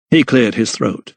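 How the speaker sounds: ordinary delivery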